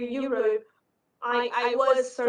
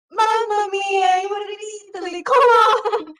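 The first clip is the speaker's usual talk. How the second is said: much higher, louder exasperated shout; exasperated Italian barista; 9 semitones higher, 8 dB louder than usual. Retold or imitated. Barista scolding her French order, loud and exasperated. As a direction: throw up your hands, mamma mia. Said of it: "And then he was again".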